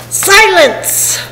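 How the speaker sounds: high-pitched royal bark; barked command. A narrator's reading. Turtle king silencing his subject, high barked order. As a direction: cut off the complaint with a kingly bark. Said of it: "the king of the turtles barked back".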